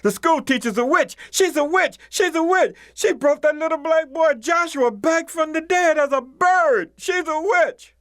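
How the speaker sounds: high-pitched frantic screams of alarm; shrill, panicked accusation, screamed out fast to the grown-ups; screaming children. Acted. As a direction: shriek it like terrified kids running to their parents. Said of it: "The children ran screaming to their parent".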